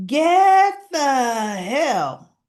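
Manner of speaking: drawn-out, loud, high yell; slow hammed-up shout; mock yell. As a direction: bellow it slowly like an angry old man. [name] yelling at Danny.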